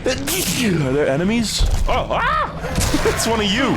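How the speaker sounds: high, loud panicked squeals; startled yelps. Real, not acted. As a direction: shriek in sudden fright. Startled by enemies appearing.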